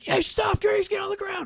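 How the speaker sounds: very high, strained imitation of a cop yelling; hammed-up high-pitched command; mock police shout. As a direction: shout orders like a cop giving chase. Acting out the cop's order.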